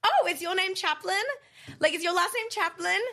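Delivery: lightly voiced friend, high and loud, playfully teasing; friend's teasing question. Friend to a stranger in the pit, mock innocent. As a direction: ask it like a cheeky theatre kid. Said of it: "He turns around and goes".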